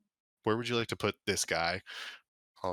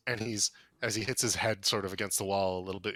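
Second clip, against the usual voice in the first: ordinary delivery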